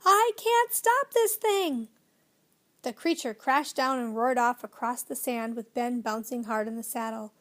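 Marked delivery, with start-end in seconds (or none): 0.0-1.9 high, loud, slow desperate yell; panicked yell. A narrator's reading. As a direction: cry out that the bike won't stop. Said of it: "giving Ben a split second in which to yell".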